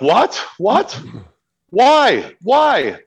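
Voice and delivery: high-pitched, disbelieving questions; imitating your own rising panic; retold alarm. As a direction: yelp each word in mock alarm. Retells his alarm at the warning.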